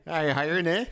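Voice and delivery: light Canadian accent with a tacked-on eh. Shown as a demonstration. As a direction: play it as a Canadian asking for a job. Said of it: "that's my Canadian accent".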